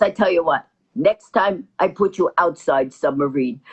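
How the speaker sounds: put-on low, threatening Russian accent. Russian pilot threatening the passenger, low accented voice. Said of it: "He goes".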